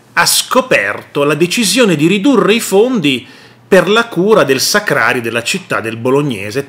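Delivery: ordinary talk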